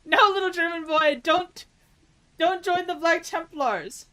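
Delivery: high, loud pleading cry, drawn out at the end; hammed-up high-pitched warning; mock pleading. Streamer warning a little German boy, mock pleading.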